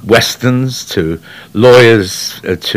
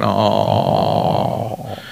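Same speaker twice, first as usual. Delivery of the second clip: deep gargling through phlegm, much lower; wet gargled voice; 13 semitones lower, 5 dB quieter than usual. Shown as a demonstration. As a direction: gargle it low in the throat. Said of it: "it's like you gargle".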